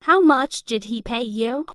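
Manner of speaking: normal talking voice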